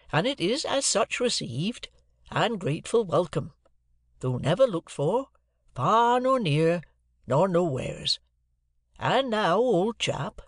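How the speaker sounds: laboured, roundabout earnestness; earnest rustic Joe. A narrator's reading. Joe's dialogue in narration.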